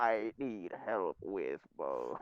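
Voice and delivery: low, slow synthesized voice; flat computer voice box; robot voice. Retold or imitated. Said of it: "That's really, really good".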